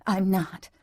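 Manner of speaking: restrained first denial; tense denial. A narrator's reading. Woman repeats denial to her lover, tense. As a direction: deny it, holding back. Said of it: "as she repeated".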